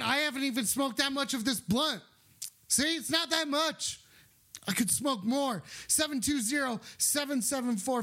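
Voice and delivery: ordinary talk, nothing marked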